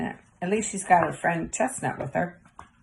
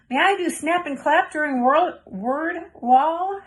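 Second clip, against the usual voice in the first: much higher, eager asking; eager child; 7 semitones higher than usual. A narrator's reading. Girl eagerly asking her teacher for a game, high. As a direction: beg the teacher to play.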